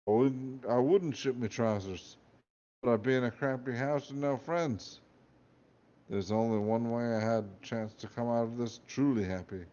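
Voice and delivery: ordinary delivery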